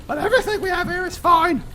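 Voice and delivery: exasperated barmaid. Acted. Barmaid lists the dishes, high exasperated voice. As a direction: build to loud exasperation at the customer.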